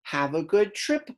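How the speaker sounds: normal talking voice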